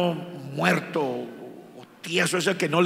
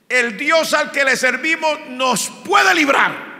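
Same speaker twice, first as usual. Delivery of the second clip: slow, loud, higher proclamation of faith; defiant shout; 9 semitones higher, 6 dB louder than usual. Acted. Three companions defying the king, shouted and defiant. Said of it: "Le dijeron al otro rey".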